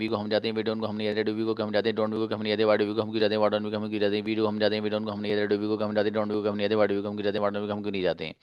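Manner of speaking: fast monotone conjugation chant; low, even, non-stop recitation at speed; rapid-fire drill. Shown as a demonstration. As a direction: rattle it all off in one breath. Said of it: "एक सांस में तीन बार पढ़ा जा सकता है".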